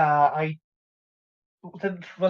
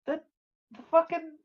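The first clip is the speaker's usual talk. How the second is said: higher-pitched, quick disbelief; can't believe who called; incredulous; 8 semitones higher than usual. Acted. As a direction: blurt it out as a stunned question. Reacting to news of CEO's call.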